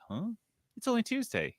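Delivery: high puzzled huh; confused surprise. Pretend. Imagined listener to host, puzzled surprise. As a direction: act baffled at the date. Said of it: "And you're like".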